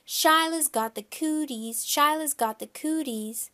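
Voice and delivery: put-on high-pitched kids' teasing shout; hollering. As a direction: holler it as a playground jeer. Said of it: "We hollered really loud".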